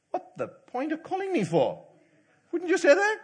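high, loud protest, acted out; exasperated complaint. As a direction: complain indignantly, pitched well up. Acted complaint at being called for nothing.

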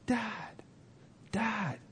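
high, soft distant call; small high voice calling from under rubble; boy calling out. Retold or imitated. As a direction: imitate a child calling for his dad, held back. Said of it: "is calling to him".

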